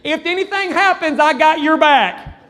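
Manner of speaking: high, loud imitation of the little guard; high-pitched, earnest mock guard voice; guard's big promise. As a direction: announce it like a tiny protector, stretching the end. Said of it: "the last thing you ever wanna hear".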